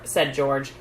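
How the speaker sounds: plain reading voice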